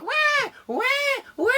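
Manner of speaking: put-on very high wailing baby cries; baby crying. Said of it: "Baby Bear, I was like".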